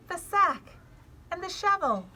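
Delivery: Flora's voice. Acted. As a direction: call it out like a keen kid. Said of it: "my voice is a little different".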